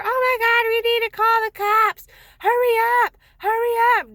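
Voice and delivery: mock a frantic alarmist urging everyone to run; psycho panic. Nephew's girlfriend rushing the daughter out, mock hysterical. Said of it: "like a freaking psycho".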